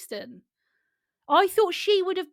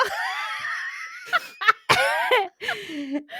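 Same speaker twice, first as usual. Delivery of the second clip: helpless shrieking laugh. Real, not acted. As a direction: crack up completely. After reading funny phrase.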